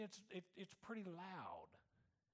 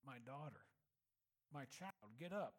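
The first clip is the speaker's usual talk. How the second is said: tender command; 7 semitones lower than usual. Acted. Jesus raising the dead girl.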